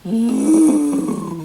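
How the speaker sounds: long moo; cow mooing. Shown as a demonstration. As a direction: moo to lead the child. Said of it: "Can you say".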